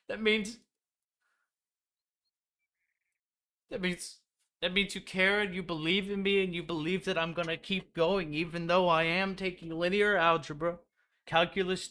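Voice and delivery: high, teary-sounding fake emotion; a hammed-up, choked-up thank you; mock gushing gratitude. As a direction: overplay being deeply touched.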